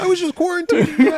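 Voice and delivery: put-on high whiny teen excuse. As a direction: squeak out a guilty excuse. Playing teen caught in room.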